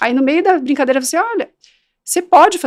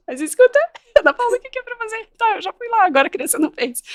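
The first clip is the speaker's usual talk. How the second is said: much higher, fast, baffled parent voice; confused parent; 10 semitones higher, faster than usual. Retold or imitated. Expert imitating a baffled parent, higher. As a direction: play the lost parent asking again. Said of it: "eles ficam assim".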